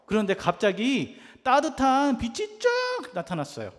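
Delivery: usual speaking voice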